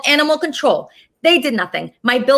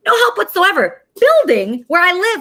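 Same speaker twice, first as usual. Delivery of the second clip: higher, louder angry blast; angry outburst; 7 semitones higher, 4 dB louder than usual. Real, not acted. Host ranting to viewers about the police, angry and louder. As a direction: let the anger spill over on this one. Ranting list of who failed her.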